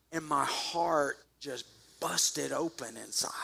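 whispered, slow; telling the church how it hit him; hushed whisper. Real, not acted. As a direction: breathe it as a confession. Recalling life-changing moment.

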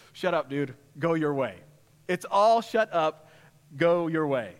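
offhand, blunt angel brushing him off; casual dismissive angel. Retold or imitated. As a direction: tell him to drop it, like a bored bro. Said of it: "the angel just says".

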